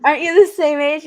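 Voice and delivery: quick, high, loud puzzled question; imitating a baffled kid; confused. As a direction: blurt it out in mock confusion. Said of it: "he's constantly confused".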